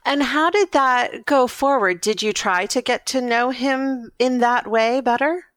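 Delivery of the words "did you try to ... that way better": ordinary talk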